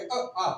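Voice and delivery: अ short, then आ long and open; vowel sound demo. Shown as a demonstration. Teacher sounds out अ and आ for students to copy. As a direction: contrast अ and आ clearly. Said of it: "जैसे हम आ बोलेंगे".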